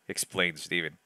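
ordinary talk, nothing marked

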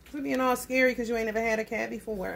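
ordinary talk, nothing marked

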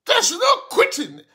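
high, loud, stunned rebuke; incredulous shout. Real, not acted. Pastor shouting down a congregant who wants to quit, incredulous. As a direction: shout the questions like you can't believe it.

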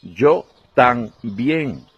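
very slow, drawn-out syllables; exaggerated slow speech. Spaniard in a Paris café ordering coffee, very slow. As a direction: stretch every word like talking to foreigners. Waiter replies slowly.

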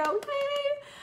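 high-pitched drawn-out yay; excited cheer. Real, not acted. Coach reacts to client's thanks, excited cheer.

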